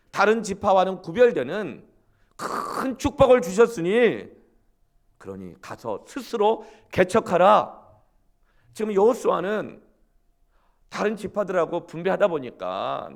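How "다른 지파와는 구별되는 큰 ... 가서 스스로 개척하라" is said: loud, slow, high proclamation; Joshua's ringing charge. Acted. Joshua urging Joseph's sons, loud slow proclamation. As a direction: thunder out the blessing and the charge.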